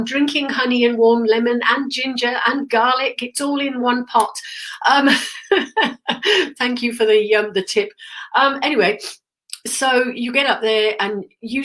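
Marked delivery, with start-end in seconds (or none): 5.1-6.5 high laugh. Real, not acted. Laughs at drink remedy.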